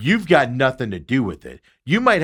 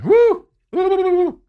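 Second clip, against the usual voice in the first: high, loud winded whooping; real out-of-breath cheering; breathless whoops; 6 dB louder than usual. Real, not acted. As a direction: whoop it out while catching your breath. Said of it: "Out of breath".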